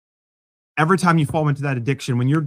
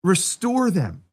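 slow restate; 4 semitones higher, slower than usual. Shown as a demonstration. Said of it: "That's hope".

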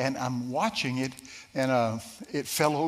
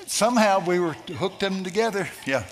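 usual speaking voice

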